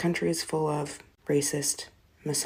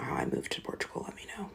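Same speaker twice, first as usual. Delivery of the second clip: whispering; 8 dB quieter, faster than usual. Real, not acted. Whispered aside.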